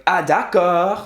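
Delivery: d'accord with mock-dramatic intonation; exaggerated English-style emphasis. Shown as a demonstration. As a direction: overplay d'accord for comic effect. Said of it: "you would never be like".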